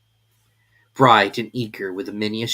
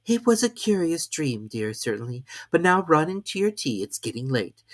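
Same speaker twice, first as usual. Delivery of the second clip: lightly higher gentle voice; tender sister; 7 semitones higher than usual. Acted. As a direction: send her off kindly. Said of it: "kissed her and said".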